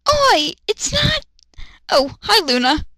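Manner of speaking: high, slow indignant then sheepish; high cartoon thug voice; squeaky Ikaku voice. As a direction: snap then soften into hi. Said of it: "Ikaku speaks".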